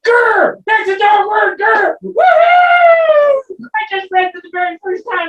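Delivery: high loud joyful yelling; thrilled shouting. Acted. Puppet dog thrilled he read his first word, shouting. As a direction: cheer it out, overjoyed.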